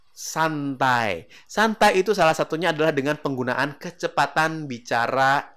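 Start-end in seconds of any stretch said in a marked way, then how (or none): 0.1-1.3 drawn-out relaxed word. Shown as a demonstration. Models slower, relaxed talking speed.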